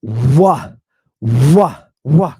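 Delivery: digamma said as a rounded "Wah"; W sound. Shown as a demonstration. Teacher sounding out the digamma, W sound. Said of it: "it's pronounced like a W".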